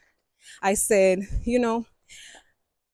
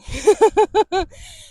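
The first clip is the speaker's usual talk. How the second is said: high laugh; 11 semitones higher, 8 dB louder than usual. Real, not acted. Laughs at own advice.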